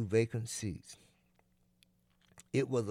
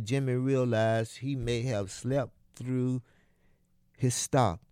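ordinary delivery, nothing marked

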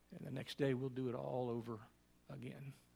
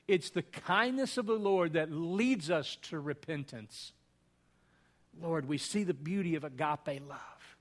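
earnest, louder praying voice; communion prayer; 2 semitones higher, 5 dB louder than usual. Acted. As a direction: lift the words up to God in prayer.

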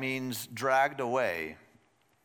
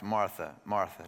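tender name; 6 semitones lower than usual. Retold or imitated. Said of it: "he simply says her name".

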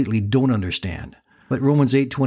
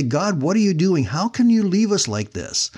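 a touch of a higher plea; lightly voiced anguished plea; mild crying out; 6 semitones higher than usual. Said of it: "we cry out and say".

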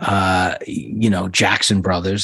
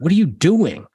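much higher, louder disbelief; incredulous father; 8 semitones higher, 3 dB louder than usual. Retold or imitated. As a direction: push the father's outrage up high. Father reacting to job story.

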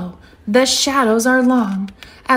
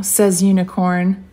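ordinary delivery

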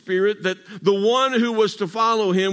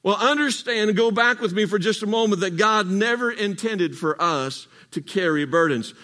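normal talking voice

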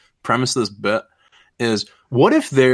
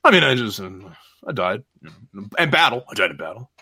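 sheepish, halting low mumble; bashful orc; 5 semitones lower than usual. Acted. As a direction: confess it reluctantly under your breath. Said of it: "looking down bashfully and shit".

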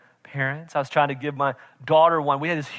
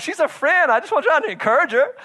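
daughter's rebuke; 11 semitones higher, 5 dB louder than usual. Retold or imitated. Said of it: "She's like".

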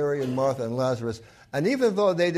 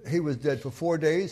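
ordinary delivery

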